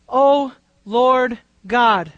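"O Lord God" drawn out word by word; slow reverent O Lord God; slow, weighty O Lord God. Shown as a demonstration. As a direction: stretch "O Lord God" slowly and solemnly. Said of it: "This is a very significant title".

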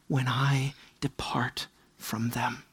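hushed whispered verse; slow grave whisper of a verse. Acted. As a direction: breathe the words of God slowly.

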